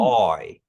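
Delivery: teacher sounds out I for students to copy; Kiowa vowel I. Shown as a demonstration. Teacher models the Kiowa vowel for the class, clear and careful. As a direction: say I as one gliding Kiowa vowel. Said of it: "Like you saying A W Y".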